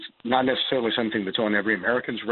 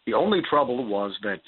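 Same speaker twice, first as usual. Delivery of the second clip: usual speaking voice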